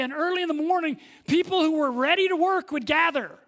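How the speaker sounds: ordinary talk, nothing marked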